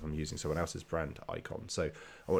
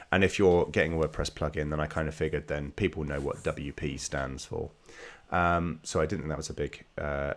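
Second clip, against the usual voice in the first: usual speaking voice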